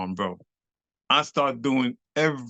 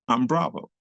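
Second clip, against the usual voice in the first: ordinary talk, nothing marked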